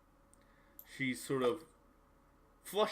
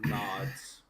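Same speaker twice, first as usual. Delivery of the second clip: whispered; 2 semitones lower, 5 dB louder than usual. A narrator's reading. GM whispers while laughing.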